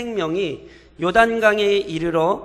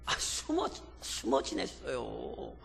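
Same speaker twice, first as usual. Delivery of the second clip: mock hushed hiding; 8 semitones lower, 12 dB quieter, slower than usual. Mocking the hiding Ephraimites.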